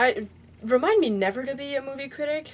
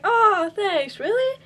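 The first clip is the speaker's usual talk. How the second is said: higher, louder mock-flattered reply; imitating pleased surprise; 9 semitones higher, 5 dB louder, much slower than usual. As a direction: act out her delighted thanks, pitch lifting up. Retold flattered reply from actress.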